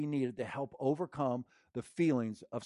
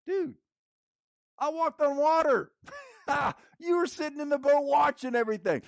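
high, loud triumphant comeback; Peter talking back; 12 semitones higher, 6 dB louder than usual. Retold or imitated. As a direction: boast to the doubters, laughing. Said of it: "Peter could say back".

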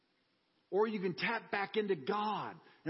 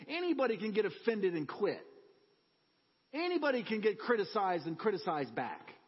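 usual speaking voice

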